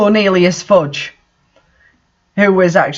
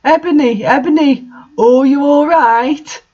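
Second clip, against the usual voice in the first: mock worried voice; 8 semitones higher than usual. A narrator's reading. Draco checking on Ebony in a high mock-worried voice. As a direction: play a fretting boyfriend. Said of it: "in a worried voice".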